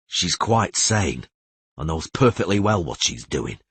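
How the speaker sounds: ordinary delivery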